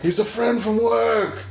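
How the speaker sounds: slow, high mock quote; a light take on Thor. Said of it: "that Thor Ragnarok trailer".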